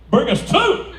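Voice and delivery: high, loud mock voice; hammed-up delighted wife; imitating eager wife. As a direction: order it with gusto. Wife orders margaritas.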